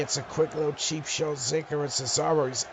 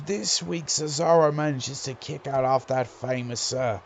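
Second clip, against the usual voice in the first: lively play-by-play call; wrestling commentator. Acted. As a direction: call the match as it happens.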